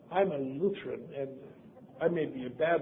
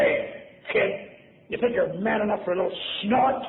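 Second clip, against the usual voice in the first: mock sailor's dare, rising high at the end; hammed-up challenge to a youngster; retold taunting sailor; 5 semitones higher than usual. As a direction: goad the kid into a drink. Drinker daring the kid, acted.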